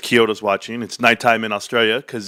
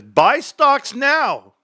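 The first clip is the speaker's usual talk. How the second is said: much higher, very slow mock pronouncement; mocking Trump quote; 11 semitones higher, much slower than usual. Mocking Trump telling everyone to buy stocks, drawn out and high. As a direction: stretch it out like a grand decree. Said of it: "he says".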